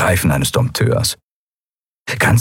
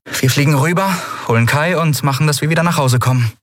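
higher, rushing toward the end; Endriel, determined; 8 semitones higher, faster than usual. A narrator's reading. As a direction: lay out the plan briskly. Endriel plans the rescue.

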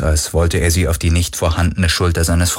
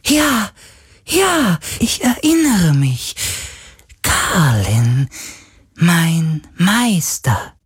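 very high, delighted ghostly voice; a spirit overjoyed at remembering; joyful spirit; 10 semitones higher, much slower than usual. Acted. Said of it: "sagte der Geist plötzlich freudig".

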